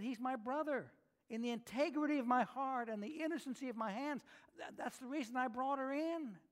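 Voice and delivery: a hint of Sarah protesting she meant no harm; Sarah pleading innocence. Sarah voiced in retelling.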